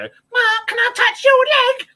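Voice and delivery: put-on high, slow girly voice; squeaky mock Tara begging; lightly camp imitation of a fan; mimicking Tara. Mimicking Tara's voice.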